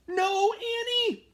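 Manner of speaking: high, loud, drawn-out cry of alarm; frantic shout. A narrator's reading. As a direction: yell to stop your sister in panic. Annie climbs down near T-Rex.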